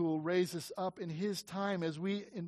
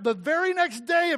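high, loud, eager the very next day; excited emphasis; 9 semitones higher, 7 dB louder than usual. Real, not acted. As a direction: urge at very next. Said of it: "excited emphasis".

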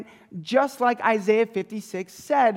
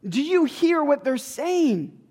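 high, loud indignant protest at Jesus; indignant priests; 5 semitones higher, 4 dB louder, slower than usual. Retold or imitated. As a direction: play the outraged priests demanding an answer. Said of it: "they go".

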